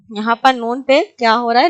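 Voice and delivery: normal talking voice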